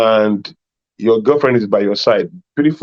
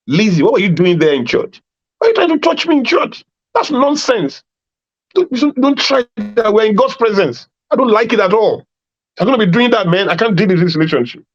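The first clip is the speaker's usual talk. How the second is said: high, shocked scolding on the phone; mock outraged phone call; 8 semitones higher than usual. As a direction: squeal your outrage at her. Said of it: "You now call her".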